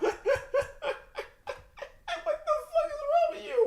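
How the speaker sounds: laughing so hard the voice cracks; squeaky laughing disbelief. Real, not acted.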